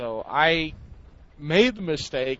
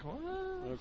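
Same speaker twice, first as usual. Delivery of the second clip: high baffled what; 15 dB quieter than usual. Retold or imitated. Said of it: "I was like".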